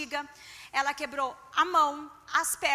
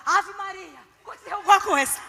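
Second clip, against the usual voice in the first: alarmed, louder retold cry; nervous coworker; 3 semitones higher, 7 dB louder than usual. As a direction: coworker panicking about the husband. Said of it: "Ela já ficou nervosa". (colega nervosa, alarmada)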